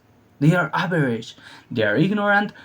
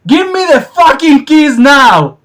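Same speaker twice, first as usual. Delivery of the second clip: high, loud barked threat; angry demand; 12 semitones higher, 9 dB louder than usual. Retold or imitated. Robber to car owner: loud high furious demand. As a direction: push the threat hard and loud.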